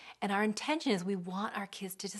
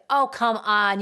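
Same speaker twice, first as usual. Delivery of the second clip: loud, brushing-off parent; dismissive; 9 dB louder, slower than usual. Retold or imitated. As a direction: wave the feeling away. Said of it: "So we deny the feeling".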